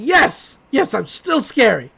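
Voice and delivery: loud, high burst of glee; an old man crowing about still being scary; triumphant cheer. Retold or imitated. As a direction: celebrate like you just won. Stan cheering he's still scary.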